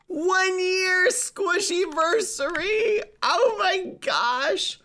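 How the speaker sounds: very high, slow cute squeal; cute anime streamer. Acted. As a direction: stretch it out like a squealing anime girl. Squeaky drawn-out cheer.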